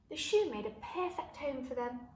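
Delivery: ordinary delivery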